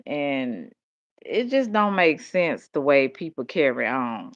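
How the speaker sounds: ordinary delivery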